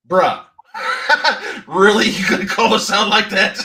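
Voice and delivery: loud, high, incredulous call-out breaking into laughs; laughing mock protest. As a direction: protest in disbelief while cracking up.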